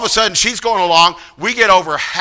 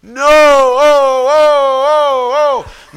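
winded groans; 6 semitones higher, much slower than usual. Retold or imitated. Exhausted climber shouting back.

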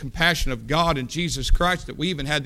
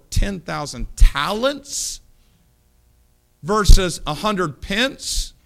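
full-force preaching; 5 semitones higher, 8 dB louder than usual. Acted.

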